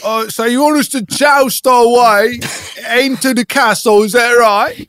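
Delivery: high, loud disbelief; incredulous shout. Acted. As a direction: question the plan loudly. Knight questioning the charge.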